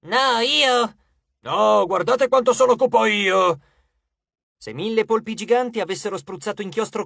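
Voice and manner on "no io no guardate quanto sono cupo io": loud, high, put-on demon voices; boastful monster clamour; squabbling demons. A narrator's reading. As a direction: outdo the last demon, loudly. Demons squabbling over who is darkest.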